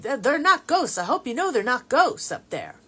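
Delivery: high, loud put-on Texas accent. Texan cousin warning they're not ghosts, insistent imitated Texas accent. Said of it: "she's saying it in her Texas accent".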